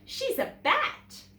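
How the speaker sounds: high, loud, slow sneer; taunting boy. A narrator's reading. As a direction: drag out the insult. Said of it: "he said".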